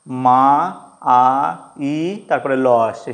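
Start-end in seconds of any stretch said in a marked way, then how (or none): 0.1-2.0 "মা, আ, ই" as separate sounds with pauses; diphthong broken down. Shown as a demonstration. Teacher splits mile into its two vowels, sounded out. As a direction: sound out মা, then আ, then ই. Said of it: "আ আর ই".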